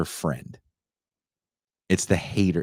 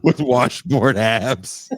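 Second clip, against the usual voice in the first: drawn-out; 4 semitones higher, 5 dB louder, much slower than usual. Real, not acted. Savoring the punchline slowly.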